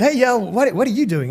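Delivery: breezy mock-American hello; American accent. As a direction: imitate a friendly American passer-by. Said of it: "this is my American accent".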